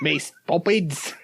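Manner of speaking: slow, drawn-out mock cockney; a hint of a hammy cockney; bad cockney accent. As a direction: stretch it out in fake cockney. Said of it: "his bad accent".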